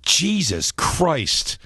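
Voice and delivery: horrified audience member, mock groan; appalled heckler. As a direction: stretch it out like a shocked guy in the crowd. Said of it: "I hear someone go".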